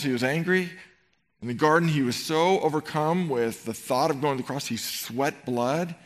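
ordinary talk

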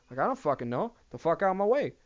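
ordinary delivery

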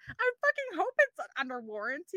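retold outrage, pitched high; high, incredulous mock protest; indignant retort. Retelling a retort to the dentist about warranty, indignant.

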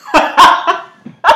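high, loud cackling laugh; real burst of laughter. Real, not acted. Cracks up at octagon remark.

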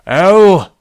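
loud call from downstairs; dad yelling. Acted. Dad yells to daughter from downstairs, loud. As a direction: yell up the stairs. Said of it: "my dad yelled from downstairs".

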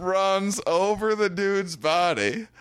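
high, slow, stretched punchline; excited high-pitched storytelling payoff; climactic reveal. Real, not acted. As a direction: draw out the twist in amazement. Story's twist lands.

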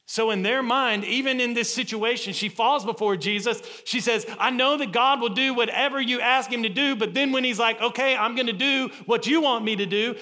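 loud, high, emphatic preacher delivery; full-force preaching. Real, not acted. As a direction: proclaim it to the whole congregation.

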